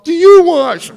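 high, loud furious shout; furious high shout at the visitor; angry yell. Retold or imitated. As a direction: bark it at the door. Ex snapping at door.

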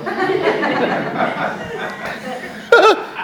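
long rising laugh; high breathy laugh. Real, not acted. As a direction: giggle up high. Laughs at own joke.

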